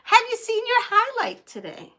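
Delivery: high, loud imitation of her son calling; son's voice. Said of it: "and says".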